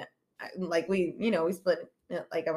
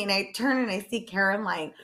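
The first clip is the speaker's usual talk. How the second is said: ordinary talk, nothing marked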